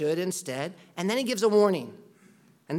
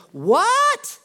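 incredulous outburst, higher and louder; shocked outrage; 5 semitones higher, 5 dB louder than usual. Acted. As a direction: burst out in a high loud What, then keep the rebuke loud. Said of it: "he's shocked".

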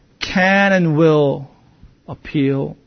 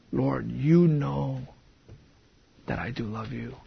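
dejected appeal; 4 semitones lower, 11 dB quieter than usual. Acted. Failing believer appealing to God, hushed and humble. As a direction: let it sink almost to nothing. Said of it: "dejected and disappointed in myself".

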